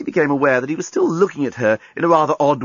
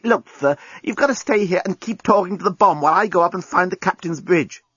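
higher-pitched exasperated instruction; urgent insistence; 8 semitones higher than usual. Acted. Lucy ordering the alien to stay, urgent. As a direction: press the orders firmly.

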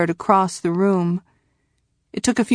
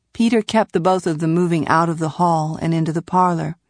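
plain reading voice

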